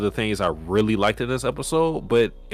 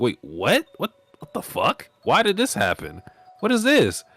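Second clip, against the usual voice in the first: surprised; 8 semitones higher than usual. Retold or imitated. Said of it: "I'm like".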